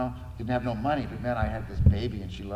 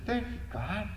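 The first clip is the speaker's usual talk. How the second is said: much higher, bursting with joy; joyful thanks; 10 semitones higher than usual. Real, not acted. Father thanking God for his new daughter, overjoyed.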